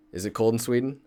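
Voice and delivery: ordinary talk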